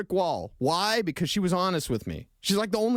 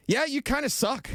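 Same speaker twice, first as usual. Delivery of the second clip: higher, breezy blunt verdict; blunt boss; 7 semitones higher than usual. Retold or imitated. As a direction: toss off the criticism lightly. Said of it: "And she's like".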